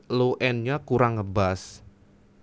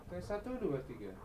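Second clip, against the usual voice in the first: much softer and higher, distant count; far from the mic; 7 semitones higher, 16 dB quieter than usual. Shown as a demonstration. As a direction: count from across the room. Distant take of mic test.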